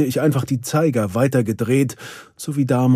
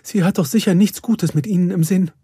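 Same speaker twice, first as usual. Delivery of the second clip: higher, worried voice; near tears; 7 semitones higher, faster than usual. A narrator's reading. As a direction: fret as if close to crying. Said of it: "Perdita war den Tränen nahe". (den Tränen nahe, besorgt)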